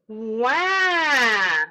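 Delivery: put-on loud wailing baby cry; baby cry wah. Shown as a demonstration. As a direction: wail like a baby. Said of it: "scream to your heart's content".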